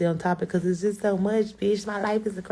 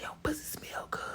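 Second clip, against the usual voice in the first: breathless whisper of his line; hushed mock of a creepy compliment; whispered imitation; 16 dB quieter, faster than usual. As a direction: whisper it like a sleazy secret.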